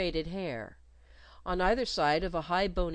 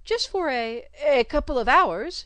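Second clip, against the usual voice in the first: higher, louder nervous stammer; flustered explanation; 8 semitones higher, 10 dB louder than usual. Acted. Governess backing away from the nurse, flustered. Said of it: "she explained".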